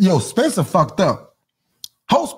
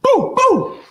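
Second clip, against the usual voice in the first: mock gunshot. Imitating gunshot sound effect.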